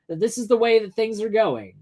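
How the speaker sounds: ordinary talk, nothing marked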